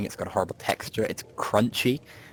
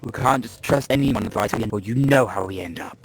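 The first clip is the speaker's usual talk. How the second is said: slimy voice; 4 semitones higher, 6 dB louder than usual. Acted. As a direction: warn them in a slimy, insinuating voice. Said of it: "slimy voice coming from".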